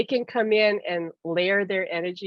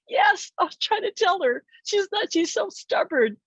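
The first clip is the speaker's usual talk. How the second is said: high-pitched mock exasperation; exasperated protest; 10 semitones higher than usual. Spirit partner complaining about her stubborn friend, exasperated protest. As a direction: quote him pleading his case, voice jumping up. His exasperated answer, acted.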